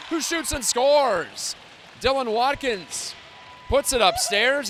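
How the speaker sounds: loud, rising goal call; excited goal call. Acted. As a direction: call the goal with a big lift.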